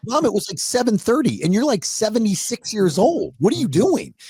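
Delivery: high-pitched, disbelieving reproach; incredulous scolding. Retold or imitated. Son taking seventy-six-year-old mother to task, incredulous. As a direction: scold a parent as if roles were flipped.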